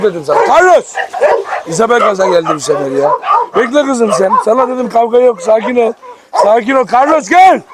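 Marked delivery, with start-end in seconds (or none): 0.5-0.9 calling the dog off. Real, not acted. Calling dog away from fight. 6.9-7.6 calling the dog off. Real, not acted. Calling dog away from fight.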